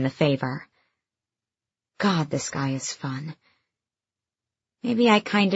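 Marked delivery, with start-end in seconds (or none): 2.0-3.4 softly, as a private thought; quiet inner thought. A narrator's reading. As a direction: murmur it to yourself.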